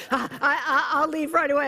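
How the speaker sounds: high and loud, stuttering; a nervous man scrambling to leave; high, loud mock panic; flustered stammer. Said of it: "And he's like".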